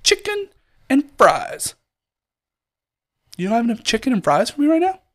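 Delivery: "Chicken" leaps way up in disbelief; indignant retold rant. To the drive-thru worker, mock-outraged and incredulous. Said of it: "I'm like".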